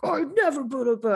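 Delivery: a high, loud hammed-up announcer; monster truck voice. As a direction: boom it like a truck rally ad. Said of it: "you read it in, like, monster truck voice".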